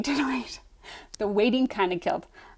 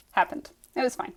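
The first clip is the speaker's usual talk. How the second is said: ordinary delivery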